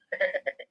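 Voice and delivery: breathy little chuckle; short teasing laugh. Real, not acted. Teacher teasing viewers who don't follow, short chuckle. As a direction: chuckle briefly at your own tease.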